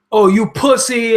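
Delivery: mock troll taunt. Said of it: "people be like".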